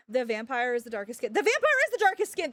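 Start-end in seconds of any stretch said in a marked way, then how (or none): 1.3-2.5 much higher and louder, indignant; incredulous raised repeat; exasperated outburst. Real, not acted. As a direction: blurt it out in disbelief. Emphatic repeat of claim.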